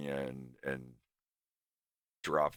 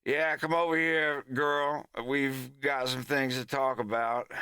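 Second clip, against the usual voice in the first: Southern gentleman character; 9 semitones higher than usual. Acted. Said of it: "he goes from playing this somber song".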